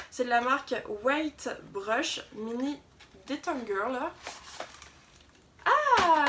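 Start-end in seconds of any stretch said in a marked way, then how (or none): 5.6-6.2 excited. Real, not acted. Opening calendar box two.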